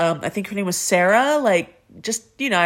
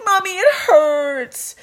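put-on whiny little girl; 11 semitones higher, slower than usual. Sick girl calls her mother.

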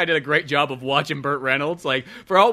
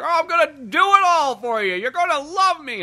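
much higher, loud smarmy promise; mock slick politician; 14 semitones higher, 5 dB louder than usual. Friend voicing the mayor candidate to the crowd, smarmy and high. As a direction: sell it like a sleazy candidate.

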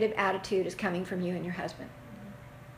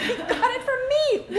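higher, slower, laughing; laughing realization; 13 semitones higher, slower than usual. Retold or imitated. Parent realizing child copied them, laughing aloud. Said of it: "you're going".

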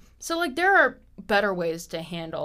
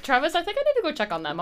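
hurried excuse; 8 semitones higher, much faster than usual. Retold or imitated. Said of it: "I'll be like".

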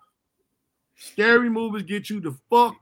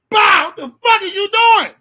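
loud, higher angry father's yell; yelling daddy; 11 semitones higher, 10 dB louder than usual. Retold or imitated. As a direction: shout at the kid like an angry dad. Daddy yelling after slapping him.

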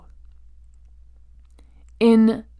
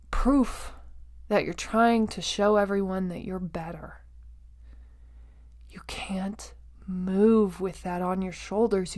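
softer, whispered intensity; 6 dB quieter than usual. Real, not acted. Speaker to listener about the weight of frustration, whispered. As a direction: lean in and whisper the warning. Hushed aside on carrying frustration.

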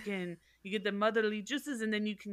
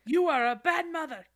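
mock scolding; 5 semitones higher, 6 dB louder than usual. Voicing killer scolding mother.

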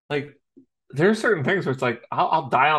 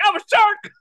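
goofy shark man; 8 dB louder than usual. Retold or imitated. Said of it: "just like".

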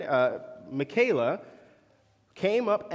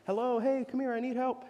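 imitating a woman calling; 7 semitones higher, faster than usual. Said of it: "she called out to me".

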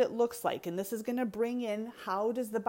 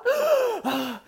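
loud, high-pitched sucked-in breath; acted-out sharp gasp of shock; startled gasp; 9 dB louder than usual. Shown as a demonstration. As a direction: gasp at a sudden near miss on the road. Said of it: "Car pulls out".

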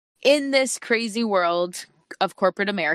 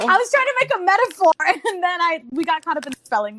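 laughing; 7 semitones higher, faster than usual. Real, not acted. Embarrassed laughing explanation.

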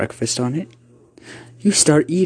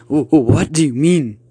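loud, raised, stammering fear; fearful; 4 semitones higher, 5 dB louder than usual. Acted. Uncle answering the creature that wants the boy, fearful. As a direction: stammer the question in fright. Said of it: "your uncle said out of fear".

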